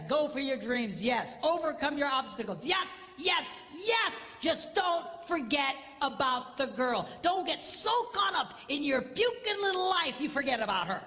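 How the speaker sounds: loud, high-pitched sermon climax; preaching at full force. Acted. Preacher rousing the men about family, loud preaching. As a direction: fire up the congregation.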